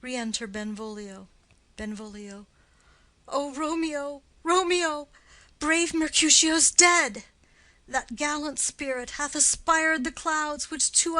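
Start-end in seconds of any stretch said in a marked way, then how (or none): 3.3-7.2 rising to a louder cry of grief on the second name; anguished news. Acted. Friend cries out to Romeo that their comrade is dead, anguished. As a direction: break the news like a wound.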